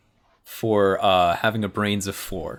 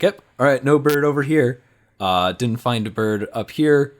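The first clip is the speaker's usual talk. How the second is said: eager Chris; 5 semitones higher, 5 dB louder than usual. Retold or imitated. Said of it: "head popping out of weird places and being like".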